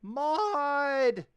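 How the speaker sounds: put-on high, loud excited child call; delighted kid yelling for his friend; child shouting name. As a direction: squeal the name like an eager little boy.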